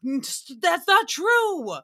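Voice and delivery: high, drawn-out objection; mock indignant protest. Viewer objecting to misinformation on the TV, mock indignant.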